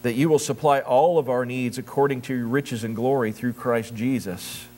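low, even, earnest prayer voice; congregational prayer. Real, not acted. Pastor praying to God before the sermon, reverent prayer.

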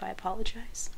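unvoiced apology whisper. Real, not acted. Whispering an apology to viewers near the mic. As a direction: whisper so as not to crinkle. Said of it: "Once again, I'll stop".

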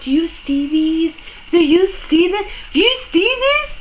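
insistent, thrilled question climbing to a squeal; building excitement. Real, not acted. Showing off palette to viewers.

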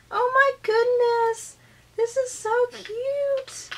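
high, slow, delighted coo; delighted gushing. Real, not acted. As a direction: gush over it, stretching the words high. Delighted reaction to gift.

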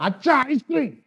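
put-on excited kid shouting for ice cream; child's excited yell. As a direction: shout it like a greedy little boy. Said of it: "അവൻ അലറുകയായിരുന്നു".

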